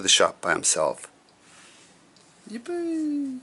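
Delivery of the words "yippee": high squeal of joy; excited cheer. Acted. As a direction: cheer it out like a thrilled kid. Child's joy in story.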